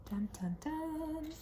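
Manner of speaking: slow, soft spooky drumroll; ominous dun dun dun. Pretend. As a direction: hum it like a horror sting. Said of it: "spooky sound effect".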